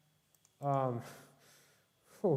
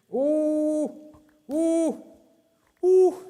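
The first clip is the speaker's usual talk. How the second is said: rising, ever higher panicked oh's; panicked yelps; 11 semitones higher, 7 dB louder, much slower than usual. Real, not acted. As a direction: yelp in alarm as the danger closes in. Fleeing a skeleton in panic.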